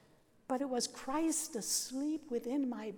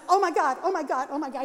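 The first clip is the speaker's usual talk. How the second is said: louder, higher panicked cry of a nervous teacher; panicked exclamation; 3 semitones higher, 8 dB louder, faster than usual. Retold or imitated. Nervous teacher gasping at his student's pants, louder and panicky. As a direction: blurt it out like a teacher about to faint.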